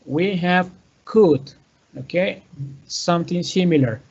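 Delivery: ordinary talk, nothing marked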